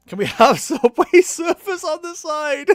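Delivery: high, loud laughing squeal; slow, laugh-choked high question. Real, not acted. Cracking up over stream requests.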